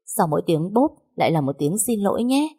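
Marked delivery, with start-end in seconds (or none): 1.9-2.5 high, sing-out fake sorry; mock-polite little apology, pitched up; insincere apology. A narrator's reading. Servant says sorry after each slap, insincerely. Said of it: "đầy giả chân của cái Trúc".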